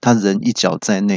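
ordinary talk

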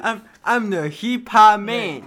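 loud, slow hype-man call; slow, loud hip hop man boast. Pretend. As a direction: shout it like a rap hype man. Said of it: "因为我是嘻哈侠".

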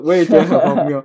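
chuckling, stress on 也; co-host laughing along about the absurd event; laughing agreement. Real, not acted.

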